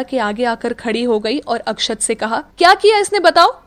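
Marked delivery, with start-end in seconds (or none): 2.6-3.6 loud, higher indignant rant; fuming at being taken for a thief; angry complaint. Acted. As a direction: vent at his sister. Akshat complains furiously.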